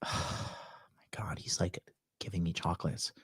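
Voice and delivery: soft exasperated whisper; hushed, fed-up murmur. Retold or imitated.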